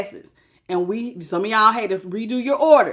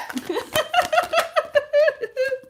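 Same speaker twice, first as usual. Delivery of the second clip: high giggle. Real, not acted. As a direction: giggle with delight. Delighted by the reading.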